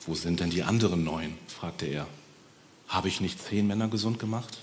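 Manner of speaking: low, soft disappointed asking; gently saddened; sad question. Acted. Jesus asks where the nine others went, soft and sad. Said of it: "war er auch etwas traurig".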